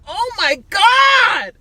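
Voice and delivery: high, slow, shouted freakout; freaking out. Retold or imitated. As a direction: blow up screaming, drawn out. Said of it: "he blows up like".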